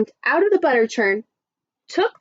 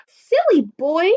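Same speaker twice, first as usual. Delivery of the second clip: mildly angry, raised-pitch scolding; angry mother; 6 semitones higher, 3 dB louder, slower than usual. Acted. Mother scolding Jack for trading the cow, angry exclamation. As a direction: exclaim it as a cross scolding, drawn out. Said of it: "Jack's mother was very angry".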